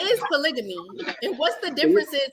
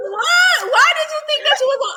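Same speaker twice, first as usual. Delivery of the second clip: high, loud what-are-you-talking-about disbelief; indignant squeal of surprise; shocked outburst; 12 semitones higher, 6 dB louder than usual. Real, not acted. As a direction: fire back in loud disbelief.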